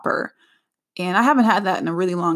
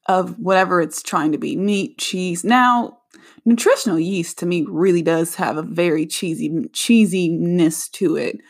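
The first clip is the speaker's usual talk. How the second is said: ordinary delivery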